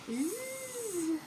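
ordinary delivery

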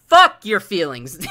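loud, slow angry shout at chat; angry yell. Real, not acted. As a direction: bellow it out, drawn out and furious. Streamer yelling at chat.

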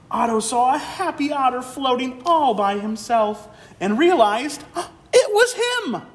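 amazed gasp. Acted. Otto sees himself in pearl.

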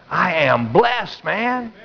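slow, weighty "I am blessed", lifting high on "man"; emphatic declaration. Real, not acted. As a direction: draw out "I am blessed" and pop "man" up in pitch. Climax of point, declared to congregation.

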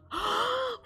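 sharp high gasp; surprised gasp. Real, not acted. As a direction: gasp in delight. Sees opossum, surprised.

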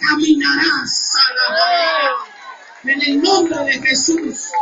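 high, slow, stretched-out preacher cry; preaching at full force. Acted. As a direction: lift the congregation, slow and high. Predicación a pleno pulmón. (predicación ferviente, aguda y lenta)

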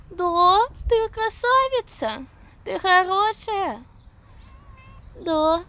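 high, slow and sweet; talking to a cat. Real, not acted.

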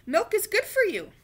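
put-on child voice urging a kitten; coaxing. A narrator's reading. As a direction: coax the kitten to drink.

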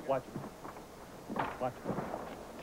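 urgent whisper, no voice; whispered warning. Real, not acted. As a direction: whisper it so the suspect can't hear. Officer warning partner in attic.

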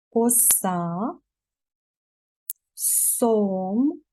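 each syllable and word said slowly and distinctly, with a crisp clear S and long pauses between. Shown as a demonstration. Teacher to young children, modelling each S syllable clearly. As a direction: model the S sound for children to repeat. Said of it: "повторюйте за мною склади і слова, чітко вимовляючи".

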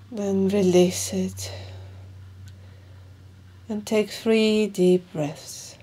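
slow, measured meditation guide with long pauses; guided meditation. Acted. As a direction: guide them calmly through each breath, leaving room between phrases.

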